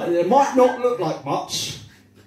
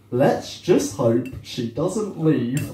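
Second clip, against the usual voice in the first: slow, lower, drawn-out punchline; mock-grave and very slow; labouring the pun; 5 semitones lower, much slower than usual. Real, not acted. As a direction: spell out the bad joke with deliberate pauses. Slow delivery of cheese pun.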